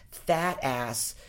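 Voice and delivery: ordinary delivery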